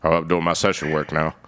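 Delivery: low, laid-back imitation of a singer. Retelling the singer announcing his session work, low imitated voice. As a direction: drop the voice well down for a casual quote. [name] retold in low voice.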